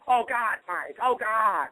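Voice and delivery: put-on high, slow alarmed cry of an old man; hammed-up horror at the injury; a boss's father gasping in alarm at a cut finger; panicked old man. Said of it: "the boss's father is just like".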